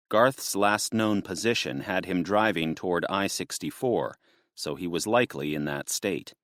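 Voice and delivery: ordinary delivery, nothing marked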